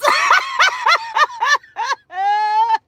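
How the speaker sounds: high shrieking cackle at her own joke. Real, not acted. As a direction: throw back your head and cackle.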